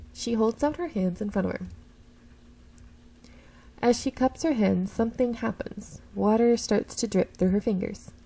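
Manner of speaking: ordinary talk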